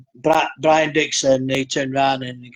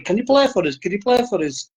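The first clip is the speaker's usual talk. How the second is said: high-pitched retold begging; mock-excited repeated request; eager plea; 7 semitones higher, faster than usual. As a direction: act out an impressed man asking eagerly. Retold bandsman begging drummer to play.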